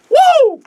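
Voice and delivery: very high, loud "woo" cheer; hyped-up whoop. Pretend. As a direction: let out a piercing whoop. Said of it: "Adam Norris is hype, so I gotta get hype".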